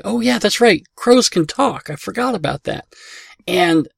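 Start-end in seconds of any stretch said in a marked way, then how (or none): 0.0-2.9 mock realization. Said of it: "it's like".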